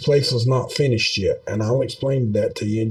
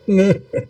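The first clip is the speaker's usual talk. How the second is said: short laugh; 9 semitones higher, 3 dB louder than usual. Real, not acted. Laughs at own yawning.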